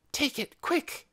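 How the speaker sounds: high, hurried hush; urgent hushed prompt. A narrator's reading. Scargo handing Dune the bundle, urgent high hush. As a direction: press it on them secretly.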